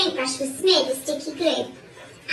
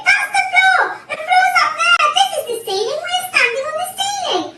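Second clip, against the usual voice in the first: high, loud panicky exclamations; screamed; 13 semitones higher, 7 dB louder than usual. A narrator's reading. Missus Twit realising they stand on the ceiling, screamed. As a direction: shriek the discovery in panic. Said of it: "screamed Missus Twit".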